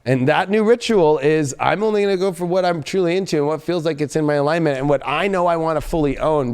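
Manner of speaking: ordinary talk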